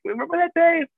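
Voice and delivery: high, lilting "remember when" voice; mock nostalgic quote. Teasing an old friend with a mock nostalgic lilt.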